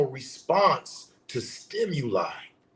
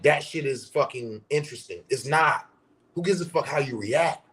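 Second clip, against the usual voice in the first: normal talking voice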